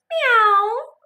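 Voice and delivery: high, loud meow; a high cat meow. Acted. Cat answers Duck.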